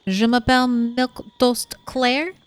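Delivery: hammed-up French accent on bonsoir; bad French accent. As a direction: greet them in a clumsy French accent. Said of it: "my bad French accent".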